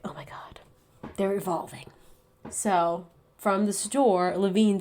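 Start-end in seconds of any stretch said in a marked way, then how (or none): 0.0-1.9 breathless awed whisper. Retold or imitated. Whispering in awe at evolving dinosaurs. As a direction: hush it like a secret discovery.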